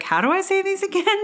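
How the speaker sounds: high, quick puzzled voice; mock confusion. Reader baffled by made-up names, high mock confusion. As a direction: pitch it up in baffled self-mockery.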